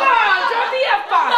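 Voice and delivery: loud, high laughing cry; laughing outburst. Real, not acted. Comedian laughing loudly at her own mistake. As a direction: burst out laughing at the slip.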